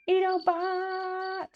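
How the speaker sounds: high, slow drawn-out cheer; excited callout. Real, not acted. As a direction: sing out the name happily.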